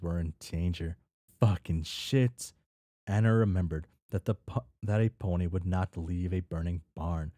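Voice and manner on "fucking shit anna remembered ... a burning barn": ordinary delivery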